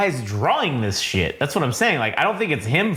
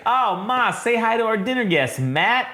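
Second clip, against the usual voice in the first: higher, put-on dinner host; acted introduction; 9 semitones higher than usual. Son presenting dinner guest Matt to his mother, acted introduction. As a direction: play a host showing off a guest.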